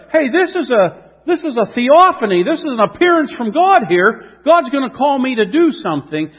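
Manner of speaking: breathless with sudden awe; excited realization. Acted. Gideon realizing an angel has come, rising excitement.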